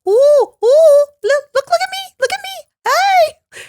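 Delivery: hammed-up squeaky calling; old photographer calling out. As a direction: imitate a photographer begging for eyes on camera. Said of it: "all he would say was".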